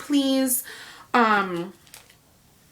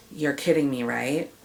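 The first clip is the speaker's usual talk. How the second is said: very calmly; 8 semitones lower than usual. Retold or imitated. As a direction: keep it calm while you can't believe it. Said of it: "And I said very calmly".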